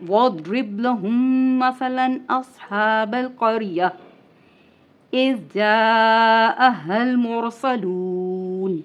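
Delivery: measured chanted recitation with a stop on "الْقَرْيَةِ"; Quran recitation. Acted. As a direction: recite like tilawat, stopping at the mim sign.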